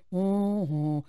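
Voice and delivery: low, mopey dog voice; doing the dog as Eeyore. Acted. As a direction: sag downward like a gloomy donkey. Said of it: "it kinda sounds like he's Eeyore".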